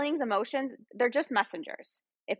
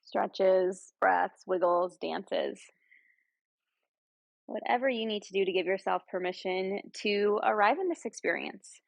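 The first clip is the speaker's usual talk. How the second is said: guided meditation; 3 semitones lower than usual. Real, not acted. Yoga guide leading friends through breath and body scan, guided meditation. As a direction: ease them into stillness, lower and unhurried.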